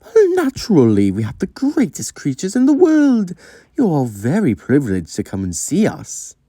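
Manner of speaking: grandiose, high-pitched haughty voice; self-important lady holding court; lofty and high, full of herself; Octavia. Acted. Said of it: "Octavia would have this grandioseness".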